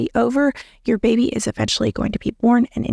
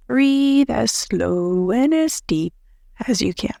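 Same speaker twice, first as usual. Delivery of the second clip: slow, drawn-out breathing instruction; calm, drawn-out guide voice; slow demo; slower than usual. Shown as a demonstration. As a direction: stretch each word like a long exhale. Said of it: "repeats the breathing tip".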